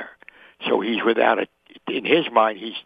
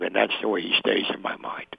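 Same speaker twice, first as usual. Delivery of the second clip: hushed whisper; 2 semitones lower, 6 dB quieter than usual. Real, not acted. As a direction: drop to a whisper on the last thought. Emotional close to memory story.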